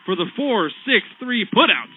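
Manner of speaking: shouting the four six three putout; excited double play call. Real, not acted.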